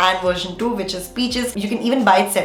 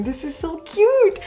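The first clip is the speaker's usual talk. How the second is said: high cooing over cute card; slow high-pitched cooing; awkward joy; 9 semitones higher, slower than usual. Real, not acted. Fan gushing over card.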